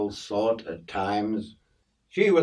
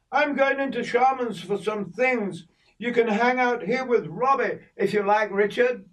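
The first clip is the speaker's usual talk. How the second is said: put-on much higher voice for old Kay; old Kay chiding the boy about hair oil, high voice; voicing Kay; 12 semitones higher than usual. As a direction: play the older woman fussing, pitched up. Kay leaves Richard with Robbie.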